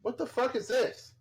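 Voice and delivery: ordinary talk, nothing marked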